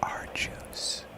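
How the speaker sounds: slow breathy whisper right in the mic; ASMR whisper. Pretend. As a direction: whisper it close into the ear. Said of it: "get A S M R close if you would".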